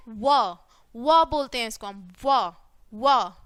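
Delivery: व punched short and loud, a low va va; teacher drilling the W sound as व; phonics sound. Shown as a demonstration. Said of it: "डब्ल्यू की sound कैसे निकलती है".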